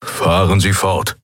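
slow, growled command from a gruff Skrier; slow growled order; growling alien. A narrator's reading. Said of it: "knurrte der Skrier".

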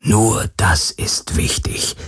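slow hushed whisper. Acted. Abschiedsworte im Flüsterton. (langsam geflüstert)

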